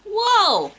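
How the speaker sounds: usual speaking voice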